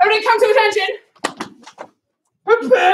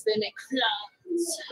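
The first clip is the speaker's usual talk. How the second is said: whisper; 4 semitones lower, 10 dB quieter, slower than usual. Acted. Secret about lion claws.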